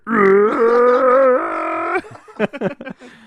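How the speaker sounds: loud, high, long mock scream; imitated death scream. Retelling what was heard on the stairs, mock death scream. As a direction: wail out a drawn-out game death. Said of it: "and I just hear".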